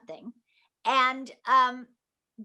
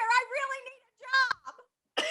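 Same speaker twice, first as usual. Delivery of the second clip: high-pitched comic begging; mock plea; 12 semitones higher, 3 dB louder than usual. Actress jokingly begging the absent director for work, high mock plea.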